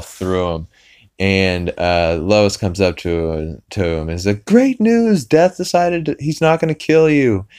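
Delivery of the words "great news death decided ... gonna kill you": high, chirpy good-news voice; excited announcement. Retold or imitated. Lois tells Peter he won't be killed, excited. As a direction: mimic a wife bursting with good news.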